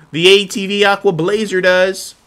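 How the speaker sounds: loud, high announcer punchline; booming ad reveal of the Blazer. Pretend. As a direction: sell it like a TV ad. Ad-style product reveal.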